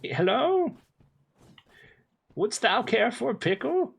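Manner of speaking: high, theatrical medieval peasant; old-timey peasant. Pretend. Streamer offering chat a pickle in high medieval voice. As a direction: offer it up like a fair peddler.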